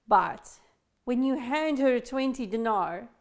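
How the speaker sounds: ordinary talk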